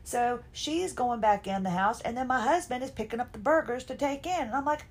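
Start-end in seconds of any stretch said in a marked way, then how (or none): none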